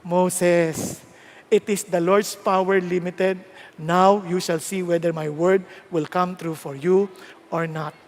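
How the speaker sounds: low, measured divine reply; God answering. Acted. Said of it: "Anong sagot ng Lord?".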